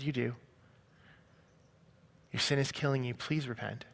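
soft, gentle insistence; hushed, caring plea in a retold voice; compassionate. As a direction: press the point softly and tenderly. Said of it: "with the most compassion in her eyes".

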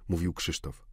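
ordinary delivery, nothing marked

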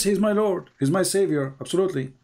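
normal talking voice

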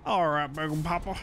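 playful put-on character voice. Driver addressing Big Papa in a put-on voice. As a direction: put on a voice for the truck. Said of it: "that's the voice I chose there".

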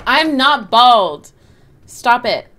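loud, drawn-out denial; loud protest. Real, not acted. Streamer telling teasing viewers to stop, loudly protesting. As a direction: push back hard, stretching the words.

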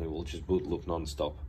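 normal talking voice

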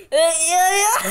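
crying with laughter. Real, not acted. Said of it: "Tô morrendo de rir".

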